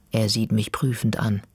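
ordinary delivery, nothing marked

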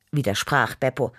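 usual speaking voice

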